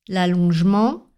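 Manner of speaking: normal talking voice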